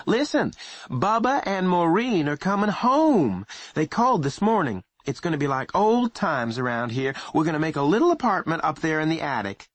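high, busy mother chattering on; fussy mother voice. Acted. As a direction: play the bustling mother.

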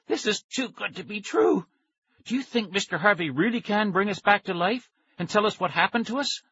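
high, breathless hope; excited high hope. A narrator's reading. Nicky hopes to live again, excited and high. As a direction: let it tumble out, thrilled.

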